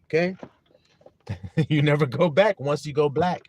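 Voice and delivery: ordinary talk, nothing marked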